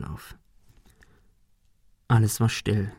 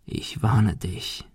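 soft, slow warning; quiet threat; 7 dB quieter, much slower than usual. A narrator's reading. Thug's parting threat. (leise, langsame Drohung)

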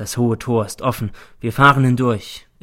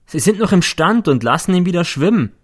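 vorwurfsvoller Schüler N; 7 semitones higher, 5 dB louder than usual. A narrator's reading. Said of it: "höre ich den N".

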